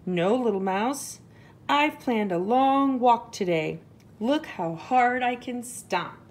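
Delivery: bold, loud proud elephant. Acted. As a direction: boast like a big elephant. Elephant answers.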